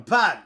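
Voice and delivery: Pan said loud with a sharp drop; falling tone Pan. Shown as a demonstration. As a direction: hit Pan hard and let it fall. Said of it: "falling tone".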